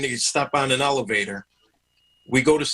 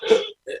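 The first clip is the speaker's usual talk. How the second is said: unvoiced gasp for air; sharp gasp. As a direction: suck in air like waking from a nightmare. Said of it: "gasp for air".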